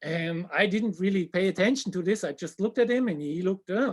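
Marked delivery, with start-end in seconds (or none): none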